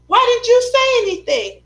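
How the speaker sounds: high, loud exasperated question; aunt's demand. Retold or imitated. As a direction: press her for an answer. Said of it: "And she said".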